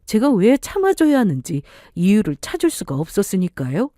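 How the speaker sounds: ordinary delivery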